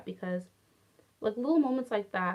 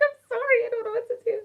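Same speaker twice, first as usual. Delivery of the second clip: mock helpless; 13 semitones higher, faster than usual. Her own mock-helpless reply.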